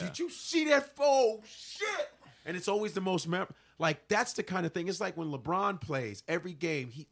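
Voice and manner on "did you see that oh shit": high-pitched awed exclamation; amazed fan. Acted. Fan reacting to players pulling their heart out, amazed. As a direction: react like you just witnessed an incredible play.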